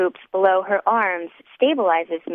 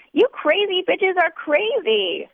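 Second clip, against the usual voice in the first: high, slow slurring wail; drunken cry; 11 semitones higher, slower than usual. A narrator's reading. Drunk narrator repeating herself to the ceiling, high slow wail. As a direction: drag it out, sloppy and drunk. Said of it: "I cry to the tin ceiling".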